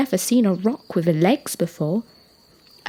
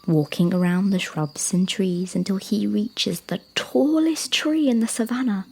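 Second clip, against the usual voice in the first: ordinary delivery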